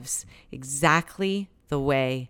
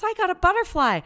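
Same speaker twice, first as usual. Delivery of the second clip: put-on high, thrilled little girl voice; a lightly voiced young daughter, thrilled; excited child; 13 semitones higher, 3 dB louder, faster than usual. Little girl showing mom her catch, excited and high. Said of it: "she goes".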